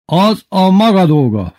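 high, loud, drawn-out rebuke; snapping at colleague. A narrator's reading. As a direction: snap at the Russian teacher. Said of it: "förmet rá az orosz tanár az igazgató". (ráförmedő, ingerült hang)

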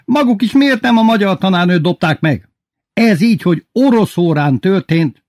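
high, loud accusation; ranting headmaster. A narrator's reading. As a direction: keep railing at the class. Headmaster continues shouting tirade. (dühös, hangos számonkérés)

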